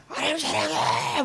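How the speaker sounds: high-pitched, slow cry of love; high slow prayer. Retold or imitated. Preacher reenacting her car prayer, high and drawn out. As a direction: reach up and stretch the words out. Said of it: "이 목소리 막 목이 터더라".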